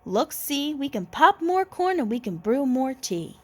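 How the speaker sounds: high squeaky mouse voice, soothing. Acted. Mouse squeaks to calm the crying bear. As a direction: comfort the bear in a tiny squeak. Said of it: "Mouse squeaks".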